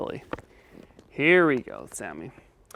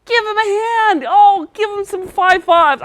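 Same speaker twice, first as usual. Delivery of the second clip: excited cheer; 13 semitones higher, 4 dB louder than usual. Real, not acted. Cheering child's success.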